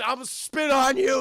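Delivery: high, loud hammed-up threat; defiant mock shout. Danny Glover to his torturer, mock-defiant shout. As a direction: hurl the insult like a beaten hero.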